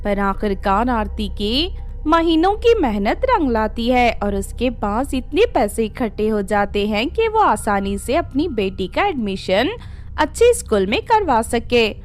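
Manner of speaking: ordinary delivery, nothing marked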